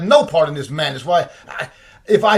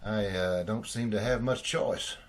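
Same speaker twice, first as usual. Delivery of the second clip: much lower, much softer surrender; defeated; 9 semitones lower, 12 dB quieter than usual. Acted. Richard cornered by the debts, quiet defeated surrender. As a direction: give in under your breath.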